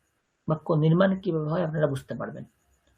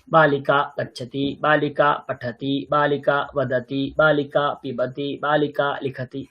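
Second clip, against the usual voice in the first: steady, monotone chant-like rhythm; sentence-drill recitation; 4 semitones lower, slower than usual. Shown as a demonstration. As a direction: recite each pair evenly on one pitch.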